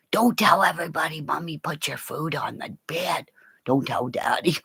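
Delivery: low hushed whisper; secret whisper. Real, not acted. Whispering to the dog so the viewers won't hear.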